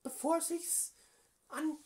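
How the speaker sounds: höhere, gespielte Politikerinnenstimme; Frauenstimme. Acted. Prank call as AfD woman. (verstellte Frauenstimme am Telefon)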